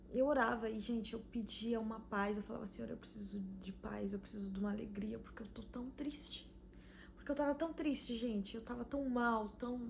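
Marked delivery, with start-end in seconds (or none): 2.7-6.5 soft pleading whisper to God; a retold whispered plea for peace; whispered prayer. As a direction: breathe the prayer out, barely voiced.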